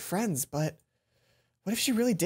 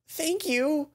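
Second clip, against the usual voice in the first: higher, rising grateful thank you; right tone; 9 semitones higher than usual. Shown as a demonstration. [name] thanks Mai in a high, rising, grateful tone. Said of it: "There we go. That was the right tone".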